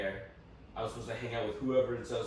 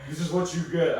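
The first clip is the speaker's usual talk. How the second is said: mock stern dad; 6 semitones higher, 7 dB louder than usual. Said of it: "my dad was like".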